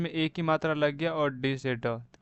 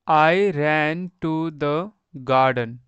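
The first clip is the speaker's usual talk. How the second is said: slow reading aloud; 5 dB louder, much slower than usual. Shown as a demonstration. Teacher reads sentence for class.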